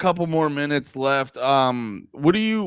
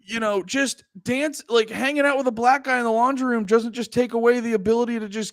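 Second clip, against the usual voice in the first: high-pitched hammed-up pleading voice; mock earnest confession; 7 semitones higher than usual. Leader explains himself to gang, mock earnest confession. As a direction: plead your case in a high, earnest put-on voice. Playing the reformed lead, pleading.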